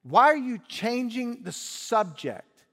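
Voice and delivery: high, loud exasperated outburst; frustrated son shouting back at his father. Acted. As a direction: throw up your hands in disbelief. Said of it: "The son is frustrated".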